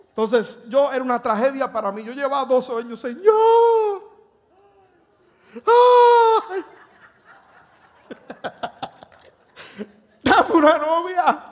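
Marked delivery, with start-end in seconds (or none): 3.0-11.4 mock crying plea. Retold desperate prayer for girlfriend.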